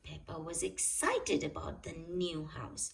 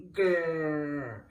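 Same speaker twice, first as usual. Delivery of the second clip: dinosaur growl; 5 semitones lower, 4 dB louder than usual. A narrator's reading.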